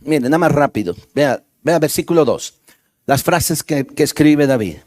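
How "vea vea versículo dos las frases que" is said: ordinary delivery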